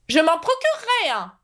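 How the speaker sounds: high knight voice, musing; pensive. A narrator's reading. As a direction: think aloud in a high character voice. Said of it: "murmura le cavalier d'un ton pensif". (chevalier songeur, voix aiguë)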